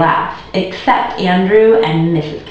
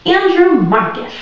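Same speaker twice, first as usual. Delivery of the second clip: calling out; 11 semitones higher than usual. Acted. Mother calling son back.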